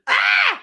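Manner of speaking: short high, loud yelp; imitated startled scream. Mimicking a teammate heard on the recording, sharp startled shriek. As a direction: shriek as if suddenly spooked. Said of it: "you can hear Angie goes".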